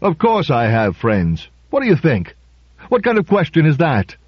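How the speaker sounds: affronted retort climbing in pitch; mildly outraged comeback; indignant. A narrator's reading. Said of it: "looked indignant".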